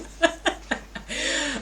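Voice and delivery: high, breathy giggle trailing off. Real, not acted. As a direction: still giggling after the apology. Giggle mid-presentation.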